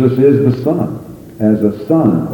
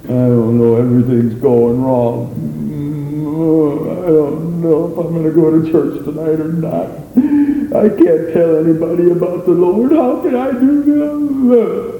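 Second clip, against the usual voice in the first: discouraged believer; 6 semitones higher than usual. Retold or imitated. Imitating a discouraged churchgoer.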